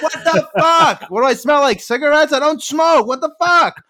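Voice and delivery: high, loud mock outrage with a laugh; hammed-up indignation at a kitten; exasperated outburst. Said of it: "I'm like".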